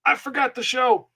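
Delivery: sudden high-pitched "dang it", a mock panic; dismayed outburst. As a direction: yelp it like you just remembered too late. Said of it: "I was like".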